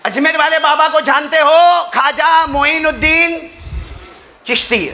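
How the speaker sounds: high, loud, impassioned storytelling; a preacher shouting the tale to the crowd; full-force preaching. Acted. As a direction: preach the story out loud, driving each line. Preacher announces a new story.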